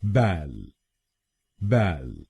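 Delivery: बैल alone as the first syllable, "bal"; isolated, falling बैल for learners to copy; syllable demo. Shown as a demonstration. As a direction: model बैल slowly as a separate syllable. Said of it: "पहले शब्दांश को यानी B A L L हम बोलेंगे".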